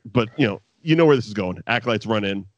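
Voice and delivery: normal talking voice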